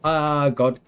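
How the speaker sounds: ordinary talk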